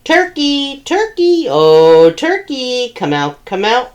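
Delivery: high sing-song calling; farmer calling out. Acted. Farmer Jake hunting for Turkey, sing-song calling. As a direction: call out across the barn.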